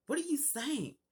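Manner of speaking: high-pitched retold disbelief; incredulous mom. Mom baffled by the diagnosis.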